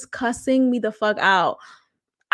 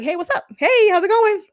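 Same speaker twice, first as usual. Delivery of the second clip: mock casual greeting; 7 semitones higher than usual. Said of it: "trying to have a regular conversation".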